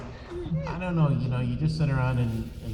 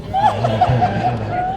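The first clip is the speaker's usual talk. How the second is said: laughing; 6 dB louder than usual. Real, not acted. Laughing over their silly noise.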